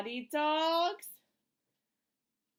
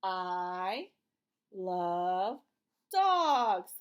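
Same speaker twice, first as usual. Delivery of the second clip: word by word; 6 semitones lower, much slower than usual. Shown as a demonstration. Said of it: "Can you say it too?".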